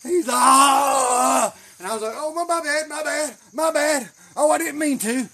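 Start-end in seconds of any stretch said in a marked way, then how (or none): 0.4-1.5 long, loud, high wail of pain; drawn-out full-volume yell, acted out; imitated scream. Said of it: "old boy screamed". 2.1-5.3 high, loud, put-on innocence; exaggerated feigned regret; mock apology. Mock apology after hurting opponent.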